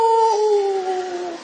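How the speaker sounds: long, high drawn-out "yahoo" holler; exultant whoop. Acted. Preacher acting out a climber at sunrise, long high whoop. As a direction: whoop like a hiker on the summit. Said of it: "막 이러면서".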